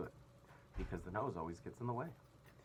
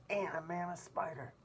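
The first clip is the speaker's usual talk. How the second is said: amazed; 10 semitones higher, 6 dB louder than usual. A narrator's reading. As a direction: savour each huge word. Captain listing wonders, amazed.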